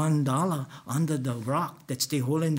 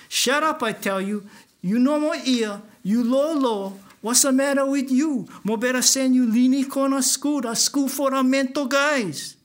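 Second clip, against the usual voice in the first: 1 angry yelling; 8 semitones higher, 5 dB louder than usual. Retold or imitated. Yelling at crying Bobo.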